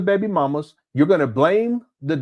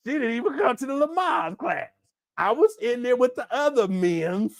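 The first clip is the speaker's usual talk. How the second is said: lightly voiced mocking impression of the father, a bit higher; mock Umar voice; 6 semitones higher, 3 dB quieter than usual. Host mocks the absent father's excuses, in a light mock voice. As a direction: play the lying dad bragging, half-hammed. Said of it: "Umar gonna be up here talking about".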